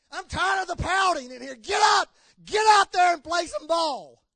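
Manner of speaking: high, loud shouted coach's rant; angry coach yelling. Retold or imitated. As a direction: yell at your team to get up and play. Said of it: "basketball coach ... was saying to his team".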